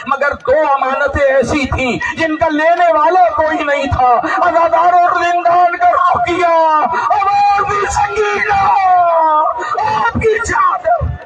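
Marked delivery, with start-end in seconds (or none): 4.4-11.2 soaring mourning cry; wailing majlis recitation. Acted. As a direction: call out to the lost child in grief. Majlis recitation calling Sakina.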